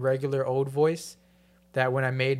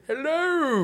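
high, falling hello; high voice test; 13 semitones higher, 4 dB louder than usual. Shown as a demonstration. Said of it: "cover your ears".

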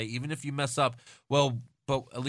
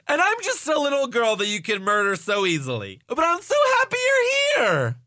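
put-on little girl voice; 10 semitones higher, 4 dB louder than usual. Mock reporter interviewing Braun Strowman.

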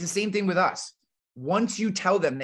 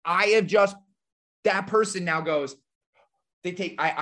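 ordinary talk, nothing marked

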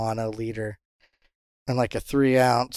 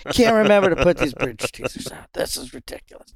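higher, mock-exasperated ribbing; giving a hard time; 6 semitones higher than usual. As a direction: imitate a mate griping about someone. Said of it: "give Jody a really hard time".

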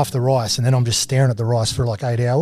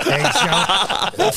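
eggs stretched very slow and high; drawn-out eggs; 10 semitones higher, much slower than usual. Real, not acted. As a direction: let eggs hang, rising. Slowed comic stress on eggs.

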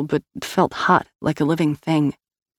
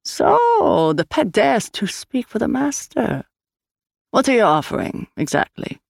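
haughty Swede; 7 semitones higher than usual. A narrator's reading. Bargaining with the pet's master, haughty.

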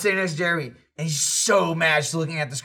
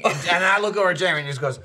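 ordinary talk, nothing marked

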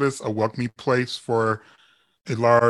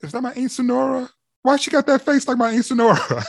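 much higher, quick and amazed; startled recognition; 10 semitones higher, faster than usual. Retold or imitated. Interviewer watching the show, seeing his aunt's look in that face. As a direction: squeal it like you just spotted your aunt's face. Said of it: "I'm like going".

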